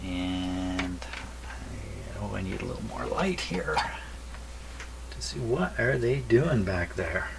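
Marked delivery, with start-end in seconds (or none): none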